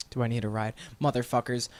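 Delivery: low, soft, bitter echo; seething, held back. Retold or imitated. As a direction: drop it low and quiet, swallowing the rage.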